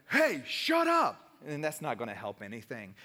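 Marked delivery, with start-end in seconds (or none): 0.1-1.2 a sharp, much higher, slow "hey, shut up"; mock his own first urge to snap at them; half-acted irritated retort; imitated snap. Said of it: "my natural would be like".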